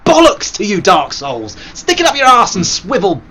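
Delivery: loud furious shout at the game; raging at the game, loud and high; angry outburst. Real, not acted. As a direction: yell it at the screen. Swearing at the game.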